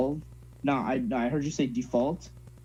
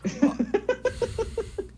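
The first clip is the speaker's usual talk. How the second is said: very high, drawn-out laugh; helpless laughter. Real, not acted. Coach laughing at the drill, helpless high laughter. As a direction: crack up along with them.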